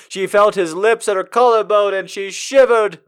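normal talking voice

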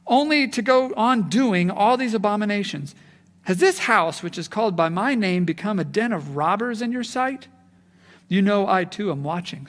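loud, forceful reading of a prophet's rebuke; preacher reading Jeremiah to the congregation, forceful and rising; scripture reading. Acted. Dramatic reading continues.

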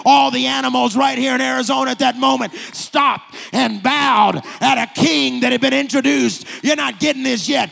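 loud, high preacher's climax; preaching at full force. Acted. Preacher at the climax for his church, loud and high.

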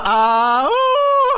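imitating uncle's bellow, ah rising to woo; mock bellow, ah then woo; loud bellow. Retelling Uncle Harry opening gifts, bellowing. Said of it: "he always lets it out".

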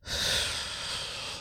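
long breathy hiss out; slow hissing sigh. Acted. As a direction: let the breath hiss out slowly. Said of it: "His breath came out in a slow hiss".

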